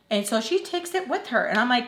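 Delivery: usual speaking voice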